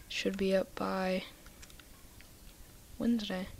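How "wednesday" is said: usual speaking voice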